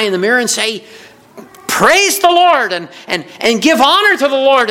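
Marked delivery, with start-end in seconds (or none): 1.7-4.7 exuberant praise. Pretend. Praising in the mirror.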